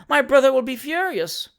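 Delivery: high, loud wailing dread; tearful despair. A narrator's reading. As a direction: lament as if bursting into tears. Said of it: "bursting into tears".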